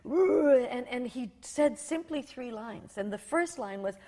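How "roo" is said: a loud rolling roo like thunder; loud mock thunder sound; thunder rumble. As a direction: imitate the thunder of his voice. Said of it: "It just went".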